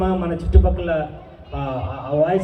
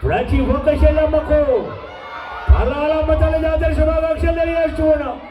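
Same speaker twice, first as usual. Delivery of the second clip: signature mimicry voice; 5 semitones higher than usual. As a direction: declaim it like a mass-movie character. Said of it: "నాకు బాగా పేరు తెచ్చిన మిమిక్రీలో voice అది". (Mimicry cinema గొంతు)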